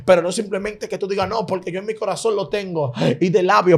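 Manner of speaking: fervent preaching. Acted. Preacher warning the congregation not to be persecutors, fervent preaching. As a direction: press the exhortation hard, pitch lifted.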